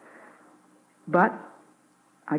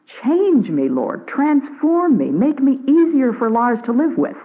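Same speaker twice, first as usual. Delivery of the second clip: pitched higher, pleading each request to God; earnest prayer; 5 semitones higher than usual. Acted. A wife praying to the Lord to be easier to live with, earnest and raised. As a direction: pray it out, one plea after another.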